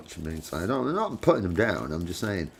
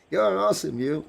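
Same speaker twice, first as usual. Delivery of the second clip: lightly voiced gushing fan, much higher; gushing praise; 11 semitones higher than usual. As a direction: squeal the praise up high in a hammed-up gush. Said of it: "That's me to Nikki".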